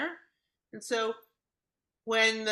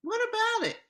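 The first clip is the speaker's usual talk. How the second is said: high mock retort; 13 semitones higher, 4 dB louder than usual. Voicing upset child's retort.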